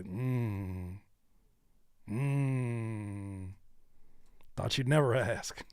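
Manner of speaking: soft, low drawn-out mm of pleasure; imitating a candy corn fan; mock delighted taster. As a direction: savor it like a treat. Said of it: "look at this and say".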